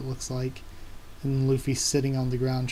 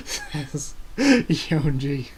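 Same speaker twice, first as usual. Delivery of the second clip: laughing; 3 dB louder, much slower than usual. Real, not acted.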